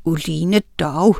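ordinary delivery

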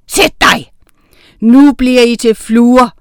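loud, commanding witch; forceful menace, high bark into loud threat; threatening evil witch. A narrator's reading. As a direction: threaten them as a wicked witch. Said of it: "truede den onde heks".

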